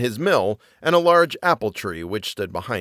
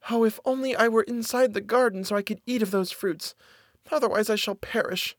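put-on high, soft, wistful girl; longing girl's soft, high plea; miller's daughter, hungry and longing; 10 semitones higher, 5 dB quieter than usual. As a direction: wish for it in a high little voice. Voices the hungry daughter's thought.

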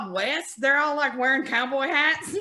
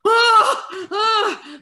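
high, loud mock scream of fright; scared cry; 9 semitones higher, 7 dB louder than usual. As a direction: act out being scared by the film. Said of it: "I felt bad for those people".

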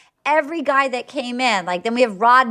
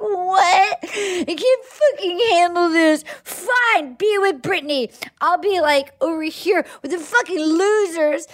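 laughing crying rant; 6 semitones higher than usual. Retold or imitated. Jilted woman half-sobbing, half-laughing at the bar, upset outburst. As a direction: wail it through laughter and tears.

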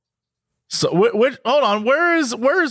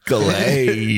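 a low, drawn-out Clay; deep Clay; 8 semitones lower than usual. Retold or imitated. Friend imitates the caller saying his name, low. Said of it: "He'd be like".

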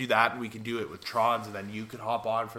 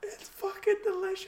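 laughing. Real, not acted. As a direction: still laughing, high-pitched. Just after the hit.

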